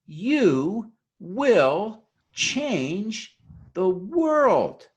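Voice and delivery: very slow, high, "you", "will", "change", "world" each punched and stretched; emphatic word by word. Real, not acted. Speaker assuring the audience they will change things, emphatic on each word. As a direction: drive home every word, rising to a loud, high "world".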